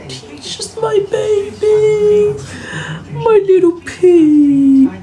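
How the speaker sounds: high, slow, crying-out coo. Real, not acted. Owner coos high and slow over her dog.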